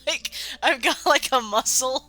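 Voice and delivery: high, fast giggling with winces; laughing through pain. Real, not acted. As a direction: laugh while it hurts your chest. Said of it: "a muscle ... that hurts whenever I laugh".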